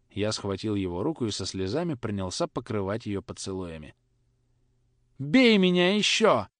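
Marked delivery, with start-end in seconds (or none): 5.2-6.5 high, slow, pleading through tears; tearful plea. Acted. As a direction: beg through sobs. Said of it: "говорил я сквозь слёзы".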